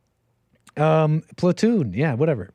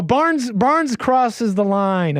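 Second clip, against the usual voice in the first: mock protest; 8 semitones higher, much slower than usual. Mock defence of film character.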